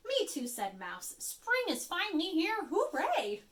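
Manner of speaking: high, bright cheer for spring; excited mouse. Acted. Little mouse cheering to Bird that spring is here, excited. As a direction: squeak out the news happily.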